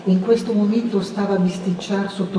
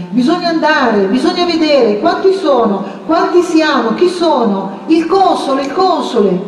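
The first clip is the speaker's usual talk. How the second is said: excited crowd; 6 semitones higher than usual. A narrator's reading. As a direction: rouse everyone at once. Tumultuous crowd consultation.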